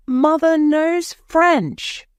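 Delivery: high, slow, drawn-out exclamation; excited cry. A narrator's reading. Bobby telling the station master about Mother, crying out. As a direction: cry it out high, stretching the words. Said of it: "cried Bobby".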